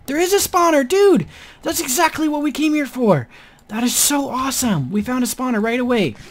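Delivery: high thrilled exclaiming; excited. Real, not acted. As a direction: shout with delight. Finds spawner.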